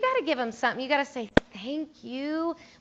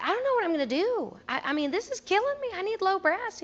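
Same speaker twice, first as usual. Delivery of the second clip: much higher, pleading voice; desperate plea; 9 semitones higher than usual. Retold or imitated. Band director pleading with the football coach, high desperate plea. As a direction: beg the coach for help. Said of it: "I said".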